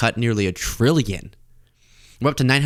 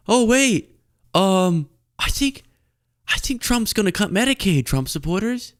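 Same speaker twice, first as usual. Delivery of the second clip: meek voice; 8 semitones higher than usual. Acted. Timid liberal warns maga crowd.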